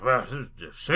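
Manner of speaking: low, soft babbling; muddled, half-swallowed rambling; babbly insane. Acted. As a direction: mumble it like you've lost your mind. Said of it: "goes all babbly insane".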